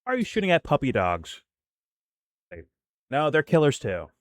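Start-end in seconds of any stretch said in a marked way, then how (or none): 0.0-1.4 high, sing-song mock-innocent pleading; mock naive defender. Mocking people who excuse Iran, high sing-song whine. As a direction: mimic a gushing apologist, lightly. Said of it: "they'll also be like".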